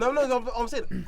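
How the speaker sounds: high, loud rapid no no no; loud protest. Real, not acted. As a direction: cut in hard to object. Heated protest in debate.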